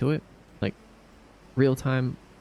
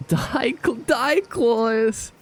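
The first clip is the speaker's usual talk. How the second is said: high, slow, amazed; thrilled disbelief; 9 semitones higher, 3 dB louder, much slower than usual. Real, not acted. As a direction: stretch it out, overjoyed. Thrilled at returning gifter.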